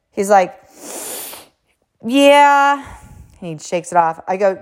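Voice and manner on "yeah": put-on high, loud, drawn-out kid answer; imitated little boy. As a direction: play the unbothered child, pitched up. Kid shakes off a fall.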